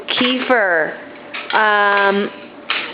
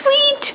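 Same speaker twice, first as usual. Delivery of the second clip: bird tweet; 13 semitones higher than usual. Pretend. Parent echoes child's bird call.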